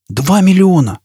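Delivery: surprised exclamation; exclaiming. A narrator's reading. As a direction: burst out at the sum. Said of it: "воскликнул Фойл".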